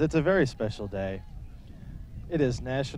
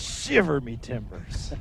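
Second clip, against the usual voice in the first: pirate voice; 4 dB quieter, slower than usual. Pretend. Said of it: "what did the pirate say".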